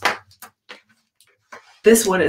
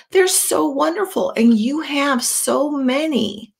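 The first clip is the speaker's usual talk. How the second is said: higher, small admiring voice; little fish pleading; 8 semitones higher than usual. Acted. Little blue fish begging Rainbow Fish, high and admiring. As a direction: beg sweetly for a scale.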